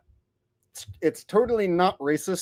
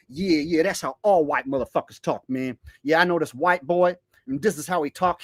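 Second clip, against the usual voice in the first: normal talking voice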